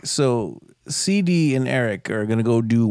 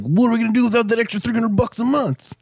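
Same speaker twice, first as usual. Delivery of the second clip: higher whiny mock of a greedy dad; a hint of a greedy foster dad; 10 semitones higher than usual. Mocking the foster dad's greed in a higher whine. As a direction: lightly voice a greedy parent. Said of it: "one of his first lines is like".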